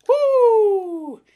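loud, high, sustained "woo"; piercing whoop of surprise; excited whoop. Real, not acted. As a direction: let out a long high whoop at the strong scent. Reaction to sniffing strong lime scent.

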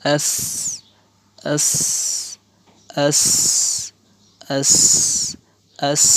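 "as" with a long hissing s; "as" repeated singly, with pauses; drilled "as". Shown as a demonstration. Teacher shows alif zabar sin jazm, drilling "as". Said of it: "উচ্চারণ".

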